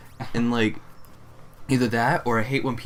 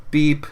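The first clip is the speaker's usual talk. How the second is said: loud, higher imitated scanner beep; 5 semitones higher, 8 dB louder than usual. Cashier mimicking the register as each item scans, loud beep.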